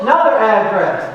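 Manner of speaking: ordinary delivery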